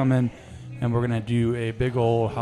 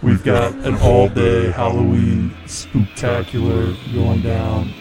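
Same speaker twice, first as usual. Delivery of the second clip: spooky voice; 3 dB louder than usual. Pretend. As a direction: announce it like a possessed host. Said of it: "we're coming back to normal here".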